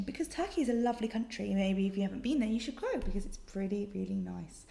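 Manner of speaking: normal talking voice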